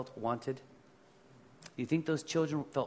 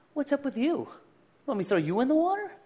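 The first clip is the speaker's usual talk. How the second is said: much higher, fast, mock outraged; mock indignant inner voice; 12 semitones higher, 3 dB louder, faster than usual. Pastor mocks his own hot-headed thought in a high fast voice. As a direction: squeak it out like a huffy kid. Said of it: "I'm thinking".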